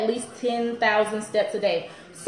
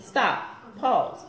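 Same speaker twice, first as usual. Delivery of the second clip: slow, softening breathing cue, fading quieter; guided breathing; 4 semitones lower, 5 dB quieter, slower than usual. Shown as a demonstration. Host leading viewers in a breath, slow and soft. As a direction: lead them into a calm breath. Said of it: "y'all do that with me".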